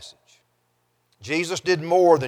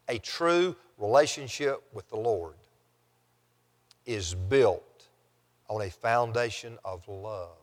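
softer, lower, broken by long pauses; 4 semitones lower, 6 dB quieter than usual. Real, not acted. Preacher restating last week's point to the congregation, measured and hushed. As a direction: let each phrase sink in, quietly.

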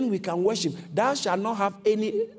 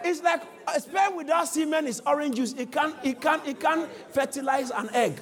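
shouted preaching; 7 semitones higher than usual. Real, not acted. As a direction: drive the point home hard. Preacher hammering his point.

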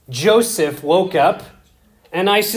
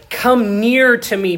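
earnest plea; 6 semitones higher, 4 dB louder than usual. Acted. Joseph pleading with brothers.

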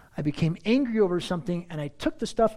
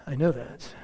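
normal talking voice

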